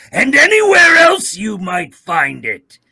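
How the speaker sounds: slow, high furious shout. Acted. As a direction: bellow it like a villain. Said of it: "to get Obito to tell people to follow".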